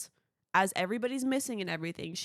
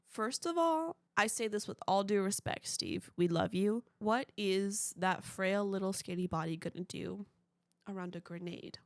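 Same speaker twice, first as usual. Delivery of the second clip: usual speaking voice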